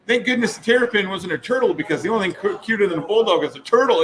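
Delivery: mock ditzy gushing; airheaded guest. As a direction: gush like a clueless fan. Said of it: "she goes".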